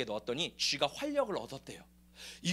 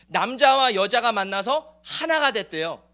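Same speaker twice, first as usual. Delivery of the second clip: normal talking voice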